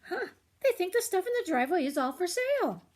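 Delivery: high, amused realization; realizing girl. Acted. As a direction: catch on and point it out. Rosie figures it out.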